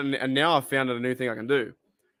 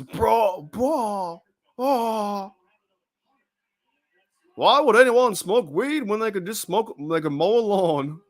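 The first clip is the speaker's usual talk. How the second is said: bad Hank Hill impression; 6 semitones higher, slower than usual. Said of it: "That's not very good".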